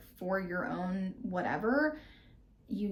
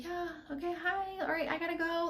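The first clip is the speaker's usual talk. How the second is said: awkward brush-off; 10 semitones higher than usual. Retold or imitated. Awkwardly escaping the uncomfortable situation.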